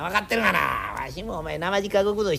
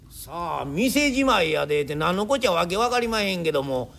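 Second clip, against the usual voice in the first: usual speaking voice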